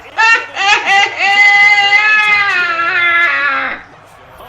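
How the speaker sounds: high, loud wheezing laughter; loud cackling laugh. Real, not acted. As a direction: burst out laughing hard. Big laugh after joke.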